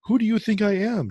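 hammed-up outraged trucker; mock indignant. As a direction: imitate the driver taking offence. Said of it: "He's all, he's all".

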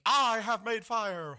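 high, slow, loud triumphant yell; Tom Hanks impression. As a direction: shout it like a castaway who made fire. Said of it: "Tom Hanks has kinda yelled".

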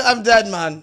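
real laughter through the words; cracking up. Real, not acted. Podcaster breaks during the scene, cracking up.

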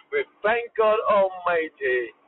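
ordinary talk, nothing marked

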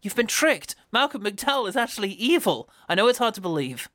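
high-pitched, hammed-up warning; mock urgent pleading. Film character warning LeVar Burton, mock urgent pleading. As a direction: plead in a high, overwrought movie voice. Acting out the film's plea.

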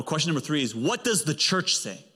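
ordinary talk

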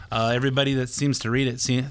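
ordinary delivery

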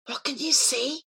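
hushed, unvoiced question; whisper. A narrator's reading. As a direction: whisper it softly. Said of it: "he whispered".

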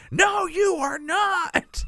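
high, slow, drawn-out mock yell of a stern mom; mom shouting no. Mom shutting down dad's plans with a slow high yell. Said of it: "your mom just like".

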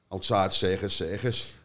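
ordinary delivery

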